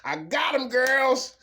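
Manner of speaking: high, loud and smug; mock gossiping woman. A woman bragging to her girls about a man, high and loud. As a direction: crow it to her girlfriends. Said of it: "she gonna talk about you like".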